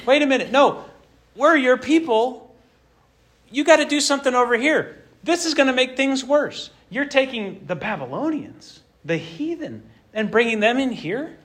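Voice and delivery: high, pleading complaint to God; indignant Habakkuk. Retold or imitated. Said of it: "He's like".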